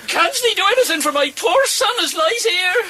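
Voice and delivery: tearful, shouted plea for her son; crying plea. Acted. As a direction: beg through sobs for the dying boy. Mother pleading over dying son.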